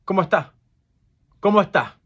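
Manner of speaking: "¿Cómo está?" run together as "comotá"; fast Puerto Rican Spanish. Shown as a demonstration. Teacher showing island speech, fast clipped "¿Cómo está?". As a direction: squash "¿Cómo está?" short, dropping the final s. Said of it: "Puerto Rican Spanish is a very fast Spanish".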